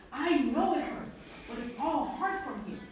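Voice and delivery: loud, slow, firm encouragement; urging coach. Retold or imitated. Coach urges struggling player, loud. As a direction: push him through the pain.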